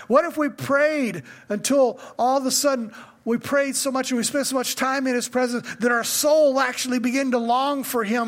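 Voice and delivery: preaching with rising fervor; building sermon. Acted. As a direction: press the congregation with urgent questions. Preaching rises in force.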